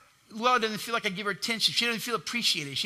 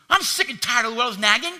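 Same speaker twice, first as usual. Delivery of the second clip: louder, higher, sick and tired whine; fed-up complaint; 4 semitones higher, 7 dB louder than usual. Acted. Pastor voicing himself complaining to God, loud and fed up. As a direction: vent your frustration loudly. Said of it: "acted complaint to God".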